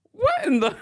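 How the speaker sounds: a sudden squeaky what in the, very high; shocked squeal. Real, not acted. Host reacting to the reality show clip, shocked squeal. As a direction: squeal it in disbelief. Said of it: "shocked high squeal".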